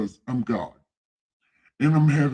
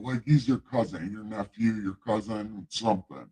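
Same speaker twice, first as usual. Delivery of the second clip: ordinary talk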